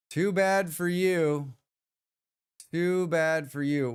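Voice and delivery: slow, drawn-out teasing; slow mock taunt. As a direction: taunt them slowly. Teasing viewers who ask.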